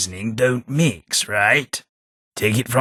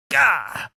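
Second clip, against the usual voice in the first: pained yelp; 11 semitones higher than usual. Acted. Hit in combat.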